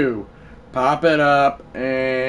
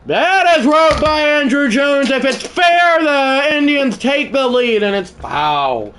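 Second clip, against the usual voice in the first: higher, rising announcer call; play-by-play call; 10 semitones higher, 5 dB louder than usual. Acted. Gamer calling a possible go-ahead hit, excited announcer. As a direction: hype the long drive. Announcer calls Jones drive.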